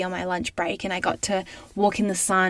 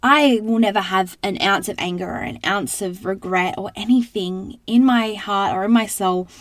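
ordinary delivery

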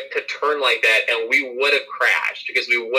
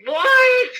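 high, loud startled screech; imitating a panicked driver's shriek; retold scream; 6 dB louder than usual. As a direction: scream it back in shock. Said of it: "and he screams".

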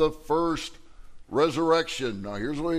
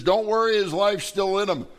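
louder, higher urgent call out; reassuring call; 6 semitones higher, 4 dB louder than usual. Retold or imitated. Paul calling to the crowd over Eutychus, loud and urgent. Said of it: "He goes down to Eutychus and says".

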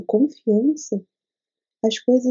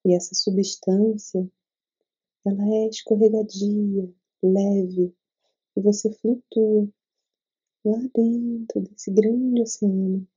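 guided meditation. Acted. Leads a meditation.

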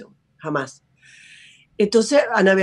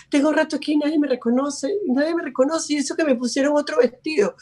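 mock crying little girl; 6 semitones higher than usual. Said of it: "lloraba y decía".

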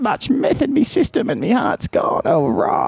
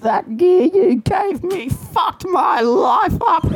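angry shout; 8 semitones higher, slower than usual. Acted. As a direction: bellow it out in outrage. Patient yells at crystal seller.

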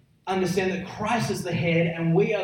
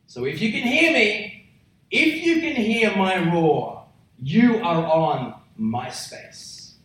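high, insistent build, then slow landing; raised, commanding territorial warning; roaring lion's warning; 3 semitones higher, 3 dB louder, slower than usual. Acted. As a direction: warn intruders off, word by word at the end. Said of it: "What that roar is saying is".

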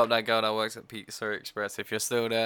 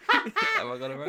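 asked through laughter, much higher; laughing. Real, not acted. She laughs at her own slip while asking. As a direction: laugh out the question.

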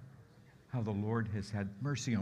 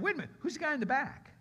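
high, fast double take; puzzled disciples; 13 semitones higher, faster than usual. Acted. Disciples counting heads in the boat, puzzled query. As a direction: pitch jumps up in sudden puzzlement.

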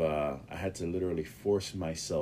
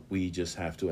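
usual speaking voice

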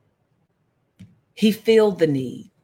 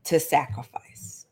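whispered, lower and solemn; hushed emphasis; 5 semitones lower, 7 dB quieter than usual. Real, not acted. Teacher repeating the first key to sacrifice, hushed. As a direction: let it sink in with a whisper.

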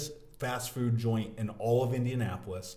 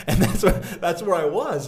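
laughing, higher and louder; 6 semitones higher, 9 dB louder than usual. Real, not acted. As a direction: laugh through the words. Laughing at own story.

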